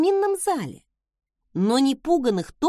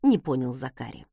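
ordinary delivery

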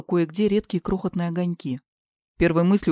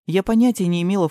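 usual speaking voice

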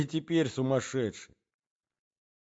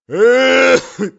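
put-on old man's cough; 18 dB louder than usual. A narrator's reading. Old man coughing in bed.

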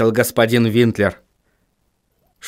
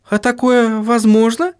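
surprised; 11 semitones higher than usual. A narrator's reading. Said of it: "удивилась Сахариса".

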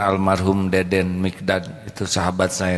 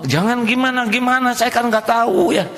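exasperated; 14 semitones higher than usual. Acted. Preacher mock-exasperated.

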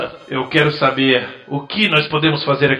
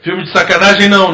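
loud refusal; 6 semitones higher, 10 dB louder, faster than usual. Acted.

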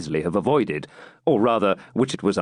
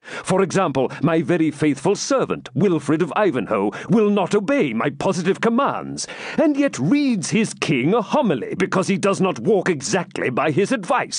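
high, emphatic royal rebuke; testy king turning the reproach round; impatient; 8 semitones higher than usual. A narrator's reading. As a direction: argue back impatiently. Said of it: "answered Richard impatiently".

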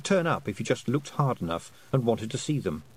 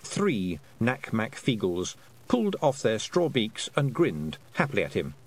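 plain reading voice

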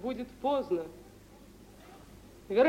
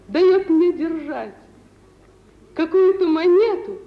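excited telling; 6 semitones higher, 11 dB louder than usual. Acted.

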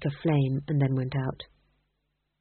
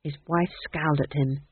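usual speaking voice

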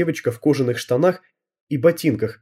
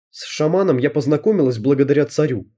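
usual speaking voice

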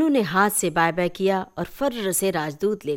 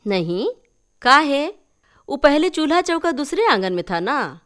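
higher, louder aunt explaining; 8 semitones higher, 6 dB louder than usual. Acted. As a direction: chat back in dialect. (चाची की ऊँची, ज़ोरदार आवाज़)

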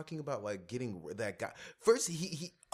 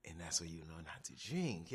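whispering; 8 semitones lower, 9 dB quieter than usual. Retold or imitated. Whispered aside in quote.

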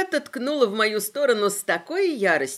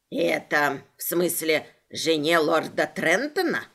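low sly leprechaun; 6 semitones lower than usual. Acted. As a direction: play the pedantic leprechaun low. Leprechaun voiced by narrator.

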